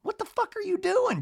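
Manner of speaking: very high mock disbelief; Madison. Madison about J T's gift, high-pitched incredulous mimicry. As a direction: imitate her, voice shooting way up. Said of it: "Madison, a talking head, goes like".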